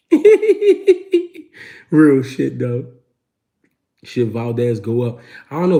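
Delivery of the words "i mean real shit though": high, loud laugh breaking in. Real, not acted. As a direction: burst out laughing mid-phrase. Laughing at own story.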